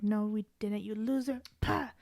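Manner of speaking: scornful quote building to a loud outburst; mocking retort. Janitor retelling a film scene to friends, mocking retort.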